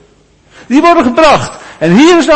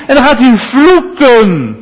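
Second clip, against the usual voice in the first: usual speaking voice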